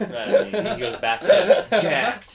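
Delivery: high, loud, helpless laughing speech; laughing hard. Real, not acted. Cohost cracks up at the mock charity pitch, laughing. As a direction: talk loudly through laughter.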